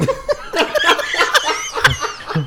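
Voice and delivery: squealing laugh; high-pitched laugh. Real, not acted. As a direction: cackle helplessly. Laughing at Hallmark joke.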